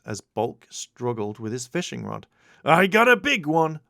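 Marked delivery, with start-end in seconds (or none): 2.6-3.8 louder, higher excited yell; scream. A narrator's reading. Bulk yelling to Skull about his catch, loud. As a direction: holler over the bent fishing rod. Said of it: "Bulk screamed".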